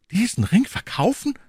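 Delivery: very high, astonished question; young hero aghast at the suggestion; incredulous cry. Acted. D'Artagnan told to sell the ring, high incredulous cry. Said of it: "rief D'Artagnan".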